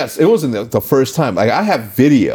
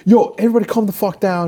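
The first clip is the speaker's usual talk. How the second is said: high retold plea to calm down; urgent intervening; 9 semitones higher than usual. Retelling how he stepped between Matt and Seth, urgent. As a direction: break up the fight, voice pitched up.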